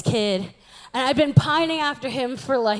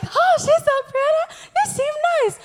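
gushing admirers; 12 semitones higher than usual. Retold or imitated. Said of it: "everyone's like".

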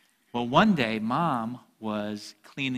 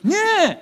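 put-on high, loud childish "meh"; mock defiant kid squawk; bratty "meh"; 10 dB louder than usual. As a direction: blurt it like a defiant toddler. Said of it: "What I really said was".

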